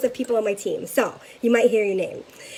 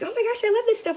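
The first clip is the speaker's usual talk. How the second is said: much higher, gushing delight; a customer at her table gushing over the products; gushing fan; 11 semitones higher, faster than usual. Retold or imitated. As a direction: gush like a thrilled customer. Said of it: "She's like".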